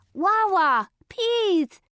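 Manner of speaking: high "Wah, wah, please" with consonants mangled; burned-tongue cry. A narrator's reading. Coyote begging for water, high garbled "Wah, wah, please". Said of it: "cried Coyote".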